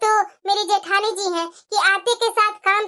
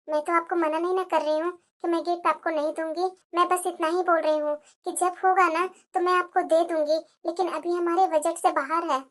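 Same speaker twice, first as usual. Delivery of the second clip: soft, subdued pleading; meek explanation; 3 semitones lower, 6 dB quieter than usual. Acted. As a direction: explain yourself quietly to an angry in-law. भाभी नाराज़ ननद को समझाती. (दबी आवाज़ में विनम्र सफ़ाई)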